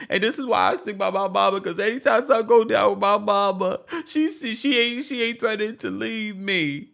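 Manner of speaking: high, fast whiny protest; imitating Kandi. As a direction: defend mama in a petulant whine. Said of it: "imitating Kandi's defense".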